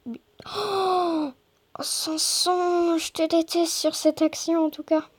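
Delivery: high, drawn-out frustrated complaint; exasperated groan. Real, not acted. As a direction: whine at the player's blunder. Frustrated at player's miss.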